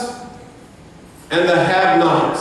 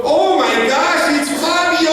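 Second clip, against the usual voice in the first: excited shout; 7 semitones higher than usual. Retold or imitated. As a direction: shriek it like a thrilled fan spotting a star. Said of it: "she goes".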